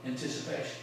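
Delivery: low, soft, held back; hushed patience. Acted. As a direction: hold it in quietly, waiting for the moment. Acting the patient, anticipating player.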